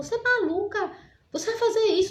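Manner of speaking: are you out of your mind, high and incredulous; shocked disbelief. Retold or imitated. Friend Soninha reacting to the plan, shocked and incredulous.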